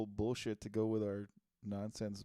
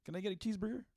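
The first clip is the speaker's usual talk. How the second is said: imitated young stoner, pitched up; stoner skater kid; 8 semitones higher, faster than usual. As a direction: play an easygoing skater asking casually. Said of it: "stoner skater kid".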